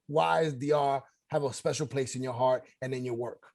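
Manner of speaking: usual speaking voice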